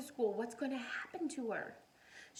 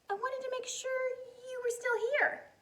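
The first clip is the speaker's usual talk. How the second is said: put-on child voice; 12 semitones higher, 4 dB louder than usual. Child voice in picture book.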